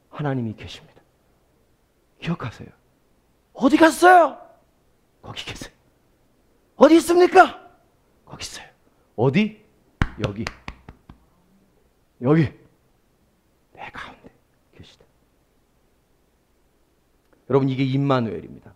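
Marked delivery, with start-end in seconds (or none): 3.5-4.4 calling out. Acted. Call and answer dramatized. 5.2-5.7 whisper. Acted. Whispered divine answer. 6.8-7.6 calling out. Acted. Call and answer dramatized. 8.3-8.7 whisper. Acted. Whispered divine answer. 13.7-15.1 whisper. Acted. Whispered answer.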